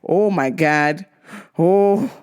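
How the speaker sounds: normal talking voice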